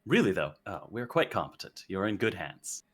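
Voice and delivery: low, quiet reassurance. Acted. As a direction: speak close and softly to him. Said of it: "not shouting this time, but actually, like, speaking directly to him".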